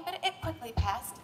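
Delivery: ordinary delivery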